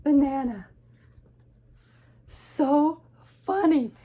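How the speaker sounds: high, loud and drawn out; tickled storybook exclamation; Gerald. Acted. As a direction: savour the joke, loudly. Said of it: "And Gerald says".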